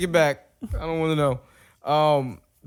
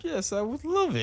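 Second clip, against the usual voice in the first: high mock reply; 13 semitones higher than usual. Mimicking the girlfriend's reply.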